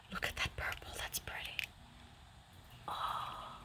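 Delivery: very soft whispered wonder with little gasps; hushed, breath-taken delight; awed whisper. Real, not acted. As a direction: whisper in admiration, barely voiced. Awed by the paint colors.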